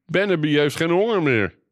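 high-pitched proclamation; calling out. A narrator's reading. Brother announces the miracle to everyone, calling out. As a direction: cry it out for all to hear. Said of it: "riep Peer nu".

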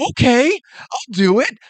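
mock a salesman who folds at once; a hint of timid surrender; weak caving in. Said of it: "That just means you're weak".

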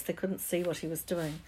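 usual speaking voice

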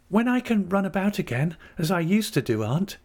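high-pitched, hopeful invalid wife; young wife Dora. A narrator's reading. Dora to her aunt about running about again, hopeful. Dora voiced in novel narration.

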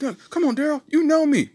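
high, loud mock pleading; pleading boss. Founder begging Daryl, high and loud. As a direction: beg like a desperate boss.

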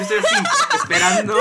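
squeaky high laugh through the word. Real, not acted. Telling friends about waiting up all night, laughing high. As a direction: break into laughter mid-word.

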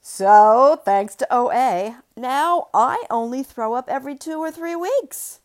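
loud, high shout; loud proud shout. Acted. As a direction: shout it to the room. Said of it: "The speaker was suddenly shouting".

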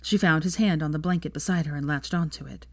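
plain reading voice

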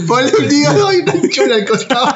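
high squealing laugh through the words. Real, not acted. Laughing while teasing his friend about liver and a girl.

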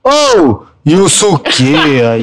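Brazilian calling out. Acted. Dubber mocking how he'd say it in Brazil, drawn-out street call. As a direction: holler it like calling a mate. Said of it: "eu tô no Brasil, eu vou falar".

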